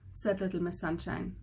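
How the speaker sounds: usual speaking voice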